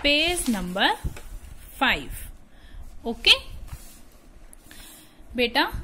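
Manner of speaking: lively classroom teacher voice; teacher reading lesson. Acted. Teacher addresses pupils with lively classroom voice. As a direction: teach young pupils brightly.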